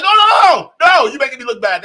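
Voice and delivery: loud, frantic no no no, fast at the end; panicked mock shout. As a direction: ham up a flustered protest. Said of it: "And Sneako's like".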